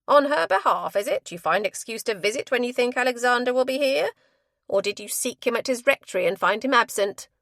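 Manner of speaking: loud, jeering matron; mocking accusation. A narrator's reading. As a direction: needle her with scorn.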